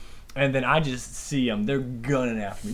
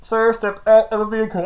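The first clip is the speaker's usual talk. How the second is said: louder, higher officer's command; police officer; 6 semitones higher, 6 dB louder than usual. Retold or imitated. Officer ordering the driver out, imitated commanding call. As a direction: call it out as a cop from behind. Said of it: "I just hear from behind me".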